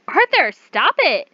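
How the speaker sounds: high, loud, slow plea to stop; crying out. Acted. Cornered woman screams at Arthur to stop. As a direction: scream his name to make him stop. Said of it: "You cried".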